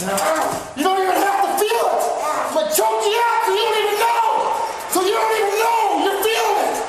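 roughhouse taunt at the dog; mock menacing yell. Playful threat to the dog.

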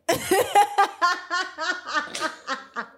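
long high peal of laughing; high laughter. Real, not acted. Reader losing it over the payroll deduction story, high laughter. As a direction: burst out laughing.

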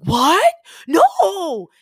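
high, loud "What? No!"; shocked outburst. Retold or imitated. Brother hearing his running shoes are gone, shocked. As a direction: burst out in alarm.

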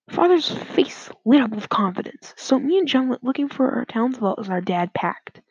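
plain reading voice

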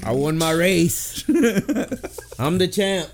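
proud reveal, drawn out; announcing big news. Real, not acted. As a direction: build up then announce the win. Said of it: "I have some exciting news".

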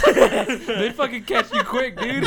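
laughing hard. Real, not acted. Laughing at the joke.

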